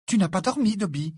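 high-pitched indignant disbelief. A narrator's reading. Hermione hearing Dobby went without sleep, indignant. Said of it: "Hermione paraissait indignée".

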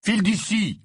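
high, sharp dismissal; curt order. A narrator's reading. Harry dismissing Créature, curt. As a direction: order it away curtly. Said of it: "lui ordonna sèchement Harry".

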